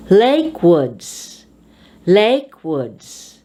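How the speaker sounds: "Lake" strong, "woods" dropping; compound stress. Shown as a demonstration. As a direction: hit "Lake", ease off "woods". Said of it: "you emphasize the first word".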